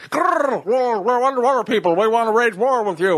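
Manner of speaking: Atlantis sea people. Acted. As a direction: rumble like an underwater warlord. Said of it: "if Atlantis was like".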